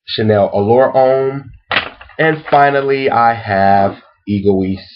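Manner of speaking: slow, spaced-out list reading; deliberate recap, pitch often lifted; slow repeat. Real, not acted. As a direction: draw out each name and pause after it. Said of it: "let me repeat it slowly".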